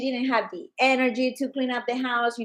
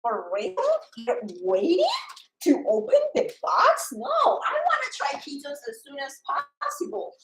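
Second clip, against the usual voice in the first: much higher, disbelieving; incredulous; 8 semitones higher than usual. Retold or imitated. Seller scolding customer for waiting, in high disbelief. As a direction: sound shocked anyone would wait. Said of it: "I'm like".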